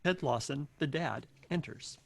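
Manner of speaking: ordinary delivery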